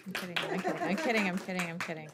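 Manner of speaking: laughing it off, loud. Real, not acted. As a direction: joke through real laughter. Joking about no applause.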